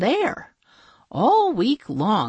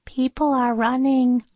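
chirpy princess; 7 semitones higher than usual. A narrator's reading. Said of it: "said Princess Sneezewort".

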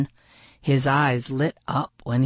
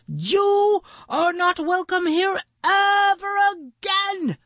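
very high, indignant decree; Maxwell angry. A narrator's reading. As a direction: banish the bully with rising force. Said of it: "Maxwell said".

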